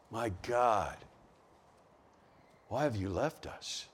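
soft, low, worn-out plea; weary prayer. Acted. A hurting believer praying, soft and weary. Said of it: "times that you feel like".

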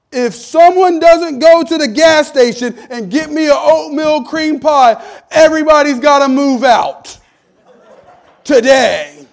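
bellowing demand, hammed-up rage; mock furious shout. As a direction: roar it like an outraged father at home. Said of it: "I say".

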